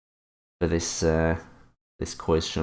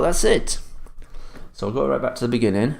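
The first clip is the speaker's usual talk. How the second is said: ordinary talk, nothing marked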